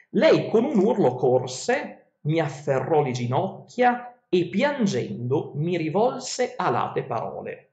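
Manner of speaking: slow, measured reading of ancient verse; epic verse reading. Acted. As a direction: read the verses like a storyteller. Reads Odyssey verses aloud.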